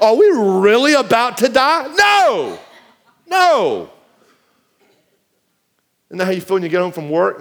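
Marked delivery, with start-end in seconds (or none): none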